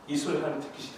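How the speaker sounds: ordinary talk, nothing marked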